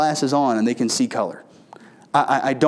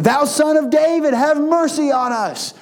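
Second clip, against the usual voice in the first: higher, imploring plea read from scripture; pleading cry; 9 semitones higher than usual. A narrator's reading. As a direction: beg for mercy like a blind man crying out. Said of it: "crying and saying".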